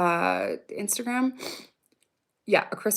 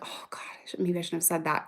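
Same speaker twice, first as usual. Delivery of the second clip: muttered self-reproach, soft and low; half-whispered regret to herself; under her breath; 4 semitones lower, 7 dB quieter than usual. Retold or imitated. Mom regretting a remark after dinner, muttered under her breath. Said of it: "like, under my breath".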